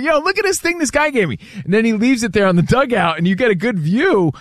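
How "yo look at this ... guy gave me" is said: excited. Retold or imitated. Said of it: "and he's like".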